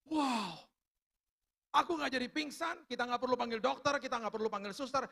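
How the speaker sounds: high, excited exclaiming; elated retold relief. Husband to wife after the fit passes, elated. Couple rejoices at escaping the fit.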